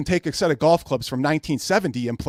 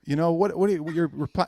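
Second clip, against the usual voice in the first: ordinary delivery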